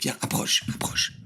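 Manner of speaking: breathy secret whisper, no voice. Acted. Streamer calling viewers close to share a secret, whispering. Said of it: "je te dis un truc".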